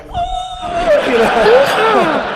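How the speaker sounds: high, loud yell of relief; relieved yell. Retold or imitated.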